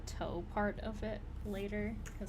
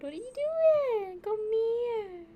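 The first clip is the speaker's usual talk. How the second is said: very high, loud, slow squeal; 14 semitones higher, 11 dB louder, slower than usual. Real, not acted. Owner squealing at her screaming cat, very high loud baby talk.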